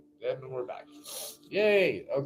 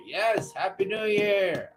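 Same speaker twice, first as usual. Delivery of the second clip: usual speaking voice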